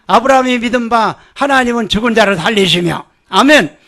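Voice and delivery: ordinary delivery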